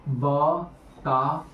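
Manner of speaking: बताओ broken into slow, separate syllables. Real, not acted. Teacher pressing students for an answer, बताओ said syllable by syllable. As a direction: spell out बताओ slowly, pausing between syllables.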